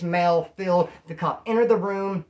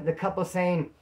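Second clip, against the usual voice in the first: ordinary talk, nothing marked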